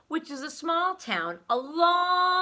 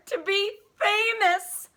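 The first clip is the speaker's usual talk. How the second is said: excited; 10 semitones higher, 6 dB louder, slower than usual. A narrator's reading. Character announces her dream.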